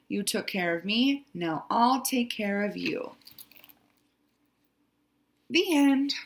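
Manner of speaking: expressive picture-book reading; story narration. A narrator's reading. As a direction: read the story aloud to young children, drawing out key words.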